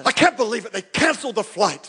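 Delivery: mock grumble. Preacher mimicking a churchgoer on hold, mock exasperated grumble.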